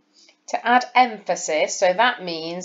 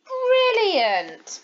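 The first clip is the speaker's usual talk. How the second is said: much higher, thrilled praise; excited. Shown as a demonstration. Teacher shows excitement, much higher. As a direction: say it like great news. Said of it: "my voice and the exclamation mark make them sound exciting".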